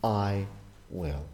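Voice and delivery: "I" and "will" set apart, "will" falling low and soft; slow, deliberate "I... will"; stress on I. Shown as a demonstration. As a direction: weigh each word: I, pause, will. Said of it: "The emphasis is always on him".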